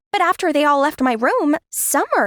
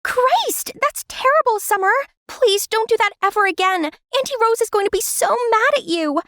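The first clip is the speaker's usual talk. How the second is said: put-on much higher girl's voice, scolding her little sister; shocked young girl; 9 semitones higher than usual. Older sister warns Summer about Auntie Rose, high and alarmed. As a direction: gasp and tell her off like a worried big sister.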